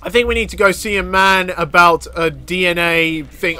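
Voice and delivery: usual speaking voice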